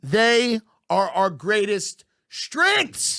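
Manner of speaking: rally the nation with a hammed-up stirring address; mock patriotic speech. Comedian mocking national pride to his cohost, grand hammed-up speech.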